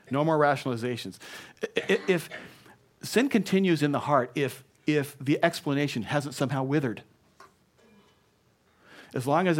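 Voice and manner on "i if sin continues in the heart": ordinary talk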